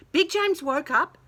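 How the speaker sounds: ordinary delivery, nothing marked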